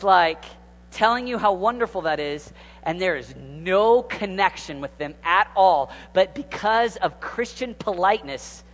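usual speaking voice

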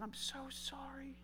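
soft slow whisper; whispered apology. Retold or imitated. Father apologizing to his daughter, whispering. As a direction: breathe the apology.

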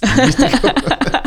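a short real laugh; amused laugh. Real, not acted. Telling Liz the story, a short amused laugh. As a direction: laugh off the memory. Laughing at his own reaction.

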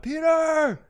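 high drawn-out call, not full volume; yelling out. Retold or imitated. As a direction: imitate calling out to someone far off. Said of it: "So I yell out".

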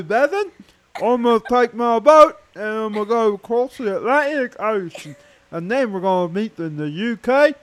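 high mock-British voice; hammed-up British accent. Host tells Bethan of the boat trip, hammed-up British accent. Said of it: "I'll do it in my best British accent".

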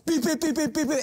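rapid high-pitched beeps; high, comic "beep-beep" sound effect; imitated pylon hits. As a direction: squeak out a quick string of beeps as comic crash noises. Imitates car hitting the pylons.

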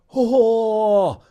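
put-on old man marveling at the peach; amazed. A narrator's reading. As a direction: stretch the ohh in wonder. Grandfather sees the peach.

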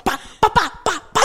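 high, popping car-backfire noises; imitated exhaust backfire. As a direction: sputter it like a blown exhaust. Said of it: "guy's exhaust going".

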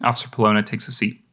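ordinary talk

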